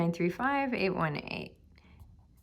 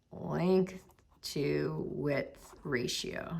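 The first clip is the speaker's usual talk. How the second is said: slow, low and trailing softer; writing aloud; 5 semitones lower, 6 dB quieter, much slower than usual. Real, not acted. Teacher writing out the parameter, slowly to self. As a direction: murmur each word as you write it. Writing the words while saying them.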